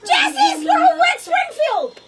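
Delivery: loud, high yell; shouted answer. Real, not acted. Answering Song Quiz with title and artist, shouted. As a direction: belt out the answer to the device.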